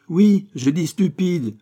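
slow, high, loud insistence; indignant. A narrator's reading. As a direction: hammer the insult home. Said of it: "en regardant avec indignation son écolier". (indignation martelée)

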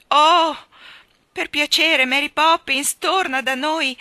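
loud, high, beseeching cry; desperate plea called out; imploring. A narrator's reading. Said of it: "invocò Jane".

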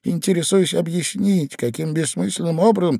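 high, indignant questioning voice; agitated Ivan. A narrator's reading. Man under the bed demanding an explanation, agitated.

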